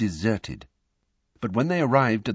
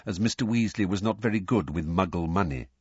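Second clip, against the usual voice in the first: plain reading voice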